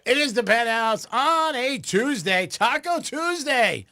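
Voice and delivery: high, drawn-out radio host intro; show announcer. Real, not acted. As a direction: stretch it out high to kick off the show.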